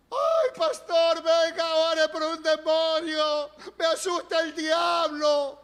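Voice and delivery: high, loud, slow mock fright; mock panicked believer. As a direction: ham up a scared churchgoer. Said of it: "se hace una novela". (creyente asustado exagerado)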